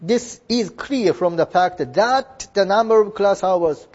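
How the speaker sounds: slow, careful English sentence; slow model reading. Shown as a demonstration. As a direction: read it slowly for students to follow. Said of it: "こっちにつながっていくわけです". (ゆっくり丁寧な英文の模範読み)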